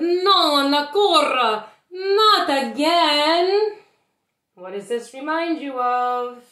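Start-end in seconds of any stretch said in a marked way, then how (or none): 0.0-3.8 loud, high, near-crying wail; slow despairing cry of not again; distraught mayor. Acted. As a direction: wail it as the flood returns. Mayor panicking at bubble flood.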